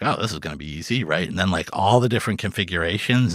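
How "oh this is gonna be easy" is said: fast, softer, breezy; naive confidence. Retold or imitated. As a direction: brush it off quickly. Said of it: "At first, I was like".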